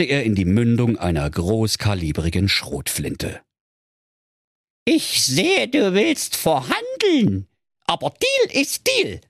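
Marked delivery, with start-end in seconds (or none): 4.9-9.2 very high, slow, squeaky dwarf voice; a sly little villain bargaining; evil dwarf. Acted. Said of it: "sagte der Zwerg".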